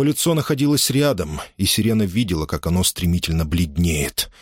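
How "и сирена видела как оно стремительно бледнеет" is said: ordinary delivery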